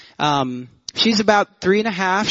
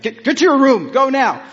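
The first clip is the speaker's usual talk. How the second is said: stern parent order; 8 semitones higher, 4 dB louder than usual. Retold or imitated. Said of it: "You're like".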